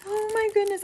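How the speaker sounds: high thrilled oh my goodness; excited squeal. Real, not acted. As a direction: squeal with joy at the gift. Opening the gift, delighted.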